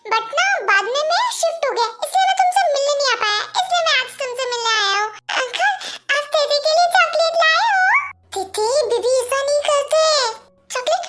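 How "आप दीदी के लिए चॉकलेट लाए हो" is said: put-on higher, eager small-child voice; little child voice. Little kid asking the uncle about chocolate, higher and eager. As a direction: play a tiny kid begging for sweets.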